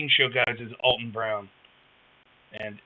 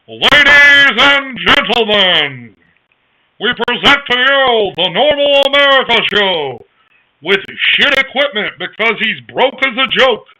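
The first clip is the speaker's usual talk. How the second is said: louder, higher and slow; old-time radio announcer; 9 semitones higher, 12 dB louder, slower than usual. Acted. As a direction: boom it out like a 1930s broadcaster. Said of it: "do I sound like old-time radio".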